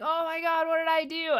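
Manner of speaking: high, loud mock dismay; mock horror. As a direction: play Ram Dass aghast at what he did. Ram Dass horrified at the monk.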